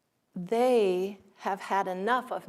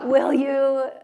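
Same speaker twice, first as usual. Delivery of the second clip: loud, higher, through real laughter; laughing restart; 6 semitones higher, 10 dB louder than usual. Real, not acted. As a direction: try again while still laughing. Restarts quote after laughing fit.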